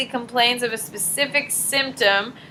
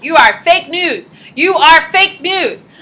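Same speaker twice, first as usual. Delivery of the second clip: slow, higher, louder accusation; mock denial; 7 semitones higher, 9 dB louder, slower than usual. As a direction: dismiss the doctor with hammed-up scorn. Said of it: "I'm like".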